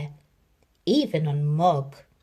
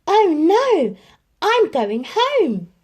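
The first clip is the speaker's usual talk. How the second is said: higher, louder, then slow panicked cry; frightened cat; 10 semitones higher, 7 dB louder, slower than usual. A narrator's reading. Mog scared by the fire engine, panicked. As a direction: flee in fright. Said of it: "She thought".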